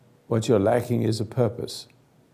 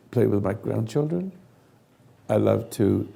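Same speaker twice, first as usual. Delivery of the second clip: usual speaking voice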